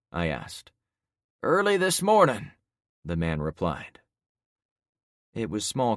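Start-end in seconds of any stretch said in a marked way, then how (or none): 1.4-2.5 witness voice. Acted. Man replies.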